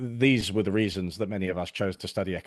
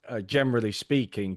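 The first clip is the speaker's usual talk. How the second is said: ordinary delivery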